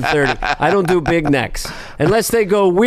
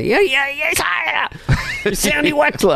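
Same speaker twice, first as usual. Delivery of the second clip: much higher squeaky character voice; Sandy Wexler voice; 13 semitones higher than usual. Retold or imitated. Sandler yelling lines as Sandy Wexler, high. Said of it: "Sandler just yelled lines".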